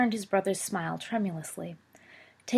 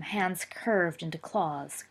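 ordinary delivery

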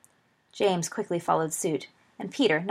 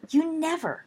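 much higher, gleeful disbelief; delighted and scandalized; 10 semitones higher than usual. A narrator's reading. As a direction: gasp it out, thrilled and shocked. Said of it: "cried James, delighted and scandalized".

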